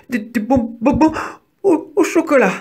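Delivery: high-pitched stuttering mouse; frightened stammer. A narrator's reading. Mouse answering the terrible wolf, stammering. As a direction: stumble on the syllables in a high, scared voice. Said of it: "balbutia Didi".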